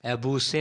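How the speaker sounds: अबूसे with a hissed "-say" ending; wrong pronunciation. Shown as a demonstration. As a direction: misread it as three syllables, a-boo-say: अबूसे. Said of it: "नहीं". (गलत उच्चारण: अबूसे, आख़िर में "से")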